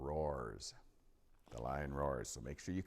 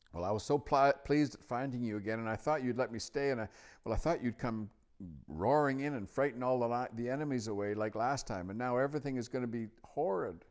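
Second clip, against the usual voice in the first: high, louder, stumbling rush of disappointment; upset Lucy, dismayed; 8 semitones higher, 6 dB louder, faster than usual. A narrator's reading. Lucy tells the lion her hopes are dashed, upset. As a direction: pour out the letdown, pitched up.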